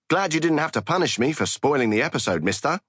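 high, fast mock Cockney; Cockney accent. A narrator's reading. Kuntz as schoolgirl after spoiling episode, high Cockney. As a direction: rattle it off in perky Cockney. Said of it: "in a Cockney accent".

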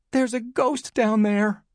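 put-on high, slow little-boy voice; frightened boy. A narrator's reading. Tom confessing to mom about the ghost, frightened. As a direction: insist on it in a high child's pitch.

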